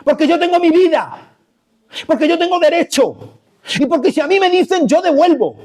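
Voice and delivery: high, self-righteous protest; indignant defiance. Acted. Preacher playing the believer on Monday, indignant and defiant. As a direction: insist on your rights, pitched well up.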